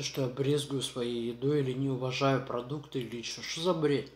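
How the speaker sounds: words around a mouthful; pausing to chew between phrases; chewing while talking. Real, not acted. Seen 0: Pursed lips and bulging cheeks between words; she eats throughout.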